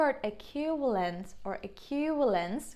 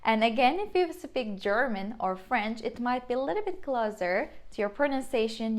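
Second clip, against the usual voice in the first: ordinary talk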